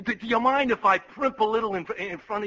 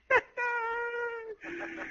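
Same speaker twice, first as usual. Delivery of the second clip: high giggle; 12 semitones higher, 9 dB quieter than usual. Acted. Aide delighted by the joke.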